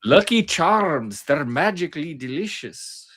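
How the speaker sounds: slow, sing-song cartoon Irish; hammed-up Irish cereal-advert leprechaun; Irish leprechaun accent. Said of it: "how I know my Irish accent".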